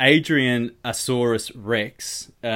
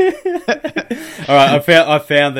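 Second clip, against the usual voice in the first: laughing through the words, much higher; real laughter, much higher and louder; 6 semitones higher, 10 dB louder, much slower than usual. Real, not acted. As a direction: laugh it out, still cracking up. Laughing while starting next segment.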